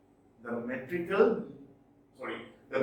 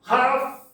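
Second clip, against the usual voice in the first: HALF hit high and loud; strong beat on Half; stressed syllable; 8 semitones higher, 8 dB louder than usual. Shown as a demonstration.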